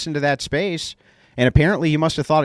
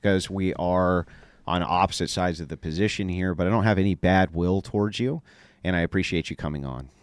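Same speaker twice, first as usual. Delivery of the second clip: cool things off and mean it; calm sincere wrap-up; 7 semitones lower, 5 dB quieter than usual. Real, not acted. Host thanking the guest after a heated debate, lower, softer and sincere.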